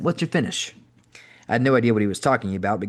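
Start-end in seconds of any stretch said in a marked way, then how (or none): 0.0-0.7 put-on higher-pitched Boston accent question. A narrator's reading. Booker quizzing new wrestler on his finish, Boston accent. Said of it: "in his native Boston accent".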